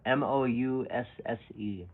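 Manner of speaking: M O U S S E spelled slowly, each letter stressed; slow, emphatic spelling of M O U S S E. Shown as a demonstration. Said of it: "I'm putting emphasis".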